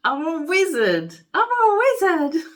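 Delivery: high, gleeful repeat, rising with excitement; high gleeful exclamation. Real, not acted. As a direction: savour the news with glee. Delighted at wizard portrait.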